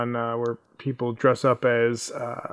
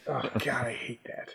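unvoiced disgusted whisper; 11 dB quieter than usual. Real, not acted. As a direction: hiss it under breath. Disgusted aside.

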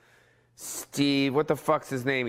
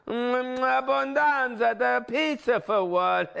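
mock Italian accent; 10 semitones higher, slower than usual.

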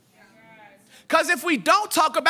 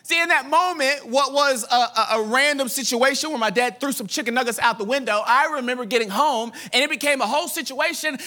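ordinary talk

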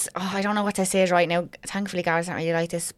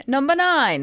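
higher, louder playful call of Number nine; sing-song number nine; 6 semitones higher, 3 dB louder, slower than usual. Real, not acted. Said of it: "repeating the count".

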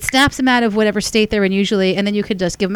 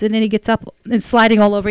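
usual speaking voice

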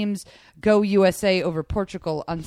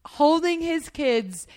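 ordinary delivery

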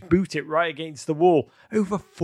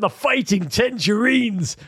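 higher, slower mock American accent; 6 semitones higher, slower than usual. Host repeats the Blackpool nickname, hammed-up American accent. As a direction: draw out the team name in an American accent. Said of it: "in an American accent".

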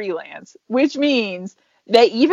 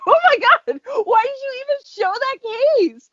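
exasperated; 11 semitones higher than usual. Retold or imitated. Exasperated retold reaction.

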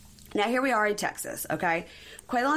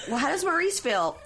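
a touch higher mock mom; lightly voiced curious mom; mom's question; 9 semitones higher than usual. Recapper voices the mom asking about Maurice. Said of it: "And Mom says".

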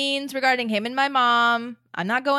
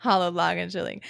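ordinary delivery

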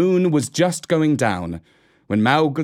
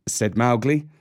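usual speaking voice

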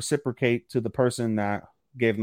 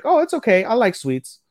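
high, louder voice imitating a pleased girl; acted girlish reply; 10 semitones higher, 5 dB louder than usual. Girl looks at the gift and answers boy, high imitated voice. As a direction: pitch way up to play the girl.

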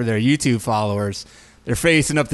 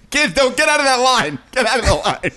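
alarmed parent; 9 semitones higher than usual. Retold or imitated. Parent pulling kids away.

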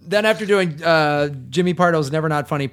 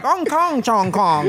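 Mickey Rooney; 6 semitones higher, slower than usual. Retold or imitated. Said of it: "he's literally like".

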